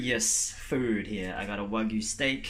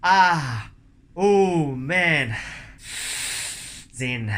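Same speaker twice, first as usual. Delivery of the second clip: in ice cold water; 2 semitones higher, 5 dB louder, much slower than usual. Real, not acted.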